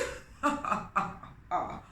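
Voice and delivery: scornful chuckle; lion's mocking laugh. King Lion laughing at Anansi, scornful chuckle. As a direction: laugh down at him. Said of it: "laughed King Lion".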